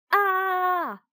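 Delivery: long, high "Ah" of panic; frightened scream. A narrator's reading. Children chased by a bone, high contained scream. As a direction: hold a high storyteller's scream. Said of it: "they all screamed again".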